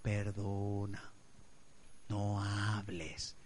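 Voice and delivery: muy lento, más bajo y suave; Espíritu Santo suave. Acted. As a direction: pide silencio con mansedumbre. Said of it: "Y el Espíritu Santo dice". (el Espíritu Santo responde a la carne, lento y suave)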